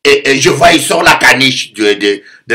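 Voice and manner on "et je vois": ordinary talk, nothing marked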